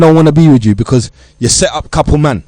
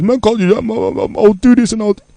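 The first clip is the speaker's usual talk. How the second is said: higher, hurried protest that breaks off; imitating a defensive young man; 6 semitones higher than usual. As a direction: play the youth brushing off the warning. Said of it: "what you're gonna say is".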